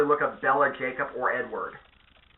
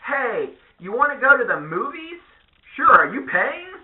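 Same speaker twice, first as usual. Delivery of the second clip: high mock-girly voice, louder by the end; mock girlfriend; 9 semitones higher, 5 dB louder than usual. Comedian mimicking a girlfriend, high and girly. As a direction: ham up the girlfriend asking for a free ride. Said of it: "Your girlfriend calls you up and goes".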